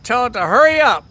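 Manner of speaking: loud, fast yelled repeat; relayed yell. Retold or imitated. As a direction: yell it out like she did. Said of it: "yelling from the truck".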